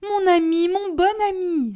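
high, loud gush of affection; overjoyed cry. A narrator's reading. Hostess throwing herself on her guest's neck, overjoyed. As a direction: exclaim as if hugging him. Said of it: "s'écria madame Bombec".

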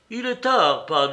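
usual speaking voice

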